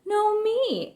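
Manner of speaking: high, pushy insistence; a cub insisting, high. A narrator's reading. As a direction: stick to it, pitched high. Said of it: "insisted Brother".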